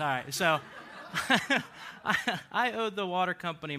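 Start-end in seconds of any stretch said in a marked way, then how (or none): 0.6-2.0 a voiceless, airy giggle; breathy laugh. Real, not acted. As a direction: laugh under the breath, embarrassed. Laughing while starting story.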